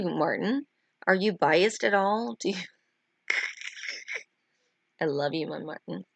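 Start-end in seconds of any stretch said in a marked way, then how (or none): none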